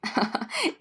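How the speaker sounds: high, soft acted giggle; little mischievous laugh; teasing chuckle. Boy laughs after pinching her.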